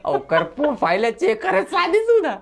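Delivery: laughing. Real, not acted. Laughing while telling.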